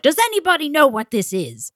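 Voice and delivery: imitating a mean old surgeon in a British accent. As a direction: put on a stern British surgeon. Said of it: "You do a really good British accent".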